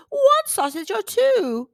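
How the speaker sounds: a huge kindly woman apologising; giant woman. A narrator's reading. Giant woman apologises to drenched Jack, slow and kindly. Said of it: "asked the giant woman".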